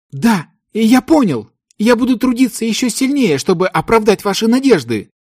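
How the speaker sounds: high eager devoted disciple; submissive. A narrator's reading. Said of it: "покорно отвечал прекрасный синеволосый парень".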